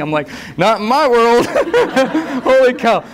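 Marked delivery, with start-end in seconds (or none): 0.6-1.4 laughing reply; amused disbelief, laughing. Retold or imitated. 1.5-2.4 high burst of laughter; big amused laugh; loud laugh. Real, not acted. Laughs at own retort.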